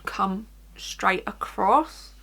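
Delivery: usual speaking voice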